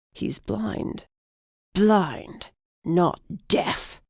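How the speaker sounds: a hint of a snarling old man; lightly snarled, old man voice. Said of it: "the griffin snarled".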